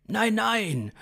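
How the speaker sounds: high-pitched protest. A narrator's reading. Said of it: "begehrte Konstantin auf".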